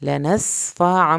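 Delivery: "لنصفعا" slow with a nasal hold; slow recitation. Shown as a demonstration. Teacher to students: slow recitation. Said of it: "چھوٹی میم کے بعد با ہے".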